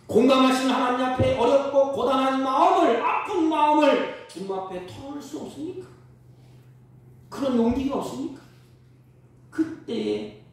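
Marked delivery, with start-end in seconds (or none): 0.1-8.4 loud, higher, urgent appeal; fervent preaching. Acted. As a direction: challenge the congregation.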